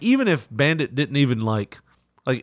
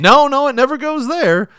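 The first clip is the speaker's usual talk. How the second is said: mom's voice; 14 semitones higher, 8 dB louder than usual. Retold or imitated. Mom voiced in story.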